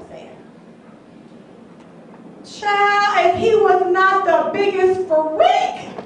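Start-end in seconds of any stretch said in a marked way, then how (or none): 2.4-5.9 high, loud, dishing the shocking news; scandalised outburst. Acted. Woman telling a friend about freaky date, scandalised. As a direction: exclaim it like outrageous gossip.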